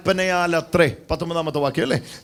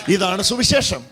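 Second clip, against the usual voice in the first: higher, louder climax of the sermon; fervent shout; 6 semitones higher, 3 dB louder, slower than usual. Real, not acted. Pastor proclaiming the gospel, fervent shout. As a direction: proclaim it with all your force.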